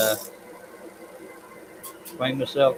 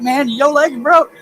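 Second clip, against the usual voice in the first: sarcastic retort; 5 dB louder, slower than usual. Retold or imitated. Mocking dad who asks for cigarettes.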